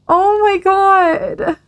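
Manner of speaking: high, slow, stretched-out oh my God; drawn-out mock dismay. Reacting to sad game messages.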